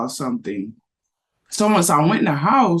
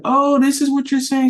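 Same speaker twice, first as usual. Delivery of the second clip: higher, brighter sudden "oh" of understanding; the penny drops; realization; 10 semitones higher, 4 dB louder than usual. Retold or imitated. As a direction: mom finally understanding her daughter. Mom realizing daughter's meaning.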